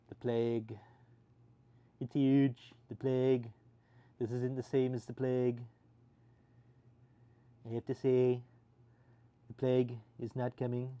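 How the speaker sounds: hint of a hammed-up German accent. Streamer jokes about the plague in a light German accent. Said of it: "now we have to speak with the German accent".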